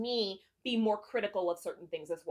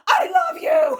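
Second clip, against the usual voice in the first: shouted much higher and louder, like a hero screaming it; screamed love confession; 11 dB louder, slower than usual. Retold or imitated. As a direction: belt out the confession like a romance hero. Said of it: "scene where they're screaming".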